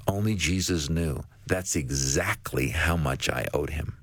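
low, slow, a touch of tears in the voice; mild tearful waitress. Retold or imitated. A waitress thanks Jesus for rent money, mildly tearful. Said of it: "and she's crying".

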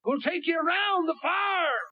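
mocking another preacher. Preacher mimics a radio preacher, mock shout. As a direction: parody the radio preacher's easy promise. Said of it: "I heard a preacher on radio the other day said".

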